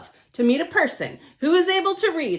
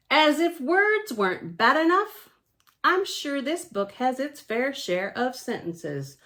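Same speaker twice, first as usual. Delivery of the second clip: usual speaking voice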